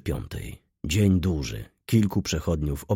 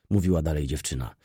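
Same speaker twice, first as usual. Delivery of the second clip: ordinary delivery, nothing marked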